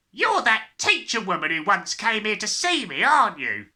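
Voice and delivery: loud, brash father's voice; a crude car dealer confronting her; rude father. A narrator's reading. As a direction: bark at the teacher. Gruff father confronting teacher.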